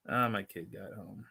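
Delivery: ordinary talk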